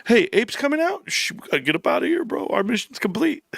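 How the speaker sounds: high, hurried heads-up to the crew; mock fleeing schemers. Streamer mimics grifters as Ape gets announced, high urgent mockery. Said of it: "They go".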